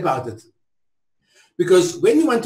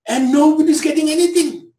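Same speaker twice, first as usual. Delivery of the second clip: higher and louder indignant cry; impassioned outcry; 8 semitones higher, 4 dB louder than usual. Real, not acted. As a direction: let the injustice burst out. Impassioned protest speech.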